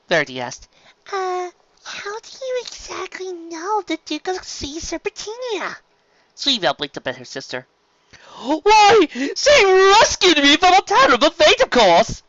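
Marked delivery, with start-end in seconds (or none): 1.1-5.8 much higher, soft, slow little pony voice; small filly, puzzled and hesitant; curious filly. Acted. 8.4-12.2 much higher, louder theatrical duke voice; over-the-top friendly serpent duke; flamboyant sea serpent. Acted.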